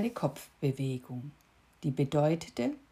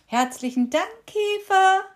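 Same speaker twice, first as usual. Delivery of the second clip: much higher, loud call from afar; 14 semitones higher, 10 dB louder than usual. A narrator's reading. Cricket calling thanks back to the beetle. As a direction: call it back while flying off. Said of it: "rief die Grille".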